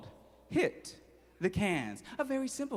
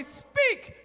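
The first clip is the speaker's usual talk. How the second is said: mom voice. Acted. Mom commanding the duck.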